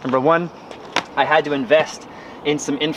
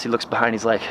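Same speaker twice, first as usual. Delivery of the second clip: ordinary delivery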